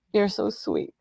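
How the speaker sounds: usual speaking voice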